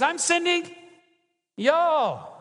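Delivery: high, drawn-out Southern "y'all" with a falling finish; Texan y'all. Shown as a demonstration. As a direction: lean into the Texas drawl on "y'all". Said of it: "this is the way he would really say it".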